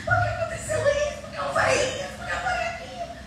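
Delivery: high, strained cries of "por que"; retold yelling. Woman retelling how she yelled at the pastor. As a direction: cry out the questions in a high voice. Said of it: "eu gritava".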